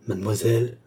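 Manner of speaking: ordinary delivery, nothing marked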